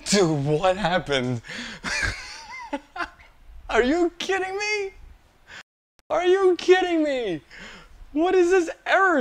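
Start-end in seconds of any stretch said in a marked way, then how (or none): 1.5-3.0 high giggle. Real, not acted. Laughing in disbelief. 3.7-4.9 incredulous high pitch. Real, not acted. Disbelief after error. 6.1-7.4 incredulous high pitch. Real, not acted. Disbelief after error. 8.1-9.2 exasperated disbelief. Real, not acted. Disbelief after error.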